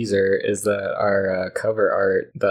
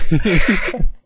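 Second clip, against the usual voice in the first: loud laugh; 9 semitones higher, 9 dB louder than usual. Real, not acted. Laughing at own joke.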